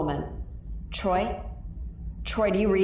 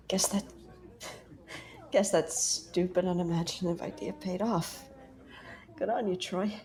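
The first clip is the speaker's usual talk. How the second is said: quiet, wistful to herself; 2 semitones lower, 8 dB quieter than usual. Acted. Quiet private reflection about Troy.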